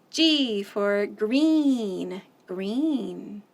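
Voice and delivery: slow, deliberate naming; slow clear teaching. Shown as a demonstration. Teacher showing the sign for green, slow. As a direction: say it slowly so kids copy. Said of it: "we make a letter G".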